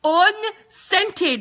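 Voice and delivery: unscented thrown out high and loud; excited emphasis. Real, not acted. Host hyping the new unscented cream to viewers, excited. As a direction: sell the word like big news. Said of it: "did you hear me?".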